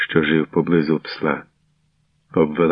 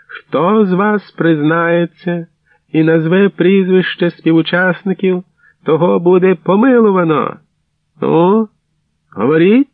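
командний вигук; 12 semitones higher, 6 dB louder than usual. A narrator's reading. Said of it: "Бартель вигукнув". (гучний, високий командний вигук)

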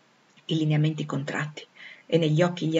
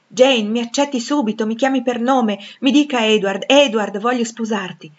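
higher, louder, forceful proposal; vehement; 5 semitones higher, 7 dB louder than usual. A narrator's reading. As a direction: demand acceptance with violent urgency. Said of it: "aggiunse violentemente". (con veemenza, a voce alta e incalzante)

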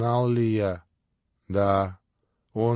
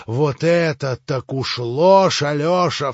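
louder, higher, slow and biting; furious cry; 4 semitones higher, 7 dB louder, slower than usual. A narrator's reading. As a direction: flash with rage at the lie. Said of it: "вскричал вдруг Иван, засверкав глазами". (Иван вскрикивает брату в ярости)

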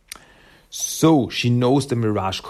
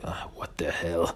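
whispered, much softer annoyed grumble; 6 semitones lower, 10 dB quieter than usual. Retold or imitated. Jennika irritated by Renette's teasing, whispered grumble. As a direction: grumble it under your breath. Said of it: "is totally annoyed".

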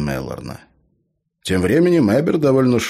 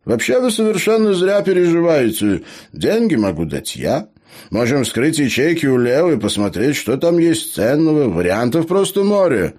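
high, louder, lively gnome voice; eager gnome, reassuring; 9 semitones higher, 5 dB louder than usual. Acted. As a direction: brush the worries away with high-pitched enthusiasm. Gnome reassures and offers money.

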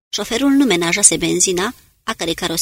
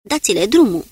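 a raised battle command, louder; shouted order; 5 dB louder than usual. A narrator's reading. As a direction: bark the go signal to throw grenades. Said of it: "și strigă". (ordin strigat, mai tare)

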